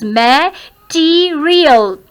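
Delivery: "Material" very slowly broken into syllables. Shown as a demonstration. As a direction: stretch out each part of "Material". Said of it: "there are four syllables". ("Material" को धीरे-धीरे तोड़कर)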